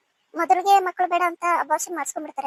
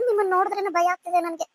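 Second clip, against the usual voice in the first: ordinary talk, nothing marked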